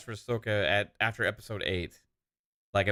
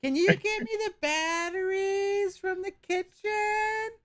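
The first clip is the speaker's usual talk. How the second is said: high-pitched mock voice; slower than usual. Voicing girlfriend's requests.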